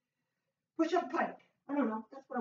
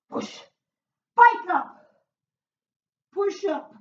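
called-out cue; 2 semitones higher, 5 dB louder, slower than usual. Real, not acted. Trainer calling the move to the class, loud call.

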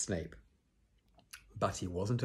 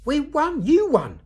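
much higher and louder cheering shout; 11 dB louder, slower than usual. A narrator's reading. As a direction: yell it in triumph. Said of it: "shouted Ron".

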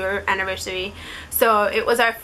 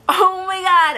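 high, louder stunned oh my God; shocked gasp; 9 semitones higher, 4 dB louder, slower than usual. Retold or imitated. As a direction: blurt it out in total shock. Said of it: "I was so shocked".

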